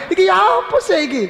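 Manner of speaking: high, fast disbelieving question; incredulous. Retold or imitated. Preacher recalls his amazement at the pilgrim, high fast disbelief.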